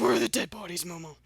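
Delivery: loud, hammed-up bad-cop demand; mock interrogator. Bad cop grilling Momo about bodies, mock interrogation. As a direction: pounce on the suspect, then ease off. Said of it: "interrogates me and says".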